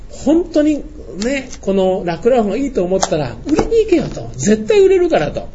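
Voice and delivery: high, emphatic retold pep talk; 熱心な説得. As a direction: urge them on, pitched well up. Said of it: "言ったんですね". (高い声で熱く説き伏せる)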